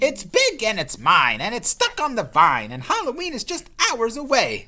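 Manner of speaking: high, loud possessive witch. Acted. Witch warning off the ghost, possessive. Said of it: "said the witch".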